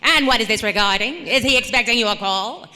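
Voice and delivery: secretary voice pranking her father on the phone; fifties secretary. Pretend. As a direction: screen the caller like an old Hollywood secretary. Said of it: "pretending to be, like, the fifties secretary".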